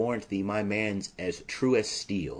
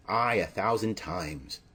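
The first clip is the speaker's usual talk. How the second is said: normal talking voice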